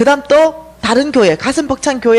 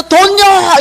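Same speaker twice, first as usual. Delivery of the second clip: higher, louder shout for money; inner shout; 7 semitones higher, 6 dB louder, much slower than usual. Retold or imitated. Heart shouting for money while the mouth says otherwise. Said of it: "이렇게 외치는데".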